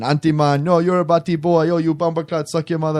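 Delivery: bad Jamaican accent. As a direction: trade insults in a hammed-up Jamaican accent. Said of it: "all this bad Jamaican accent".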